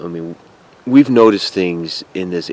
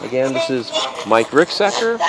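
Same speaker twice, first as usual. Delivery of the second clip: calling out; 6 semitones higher than usual. Real, not acted. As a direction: announce your name to the spirits. Calling out to spirits.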